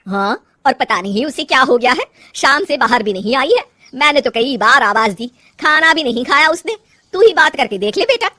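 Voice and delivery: loud, worried mother to her son; complaining mother-in-law. Acted. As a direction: report the trouble loudly, pitch climbing.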